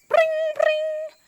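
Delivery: very high ringing; phone ring. Acted. Teacher to kids, doctor calls back, high phone ring. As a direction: ring like a telephone.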